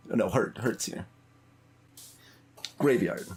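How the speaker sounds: ordinary talk, nothing marked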